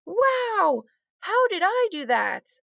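high, wide-eyed astonishment; amazed disbelief. A narrator's reading. Lucy amazed at her wand's snow, disbelieving wonder. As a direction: marvel at it in disbelief. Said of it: "in disbelief".

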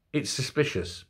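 ordinary delivery

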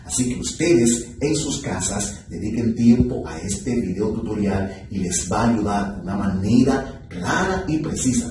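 ordinary talk

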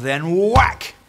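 loud, high whack; punchy whack. A narrator's reading. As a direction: punch out the sound word. Narrated big kick.

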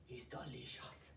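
hushed, delighted whisper. Pretend. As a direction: breathe it out in awe. Tastes the cream cheese.

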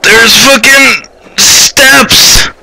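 high, slow, stretched-out alarm; alarmed cry. Real, not acted. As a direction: draw it out high in fright. Startled by steps in game.

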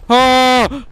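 frightened yelps climbing into panic; scared horror game streamer. Real, not acted. Panicked while fleeing the monster.

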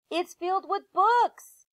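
high, loud delighted call; thrilled. Acted. Annie telling Jack what fills the treehouse, thrilled high call. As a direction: call it down, overjoyed.

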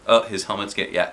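ordinary delivery, nothing marked

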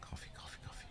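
whispered chant to herself. Real, not acted. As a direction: breathe the words under the breath. Muttering while hunting for coffee.

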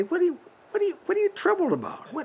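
a quiet voice nudging the anxious heart; inner voice. Acted. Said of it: "a voice will say".

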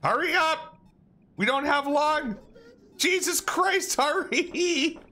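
high frantic yelling; being chased. Real, not acted. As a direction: scream at him to hurry. Fleeing, calling teammate.

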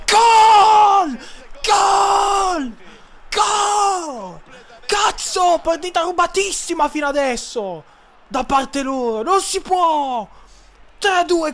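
high, loud triumphant yells; excited goal shout. Real, not acted. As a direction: scream the goal like a fan. Celebrating the goal.

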